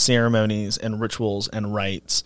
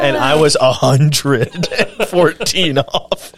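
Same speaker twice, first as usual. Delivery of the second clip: slow, laughing confession; laughing at himself; 3 semitones higher, 8 dB louder, slower than usual. Real, not acted. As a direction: laugh through the admission. Admits his own big mistake.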